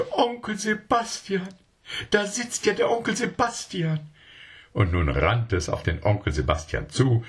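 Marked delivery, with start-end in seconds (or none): 0.1-4.0 very high cry of delighted surprise; a child spotting a beloved uncle; amazed child. A narrator's reading. Child discovering Uncle Sebastian, high amazed cry. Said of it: "vor Staunen".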